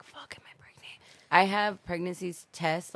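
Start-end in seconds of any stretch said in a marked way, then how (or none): 0.0-1.0 panicked whisper. Retold or imitated. Couple fearing a pregnancy, whispered panic. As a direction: breathe it out in a scared whisper.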